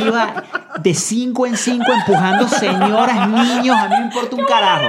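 cracking up while confessing; laughing. Real, not acted. Boasting about pushing ladies while fleeing.

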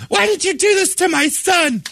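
hammed-up offended mother; outraged mother. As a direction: accuse her like a shrill upset parent. Angry mother in story.

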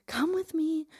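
imitating a demanding neighbor; demanding woman. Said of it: "This woman was like".

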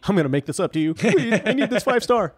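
pleading high through a laugh; laughing mock plea. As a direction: beg for the five stars, half laughing. Mock groveling to a guest.

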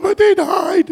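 a teary husband pleading for the movie hero; mock tearful protest. Husband at the movies, mocking his own weepy high protest. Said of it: "and I'm sitting there going".

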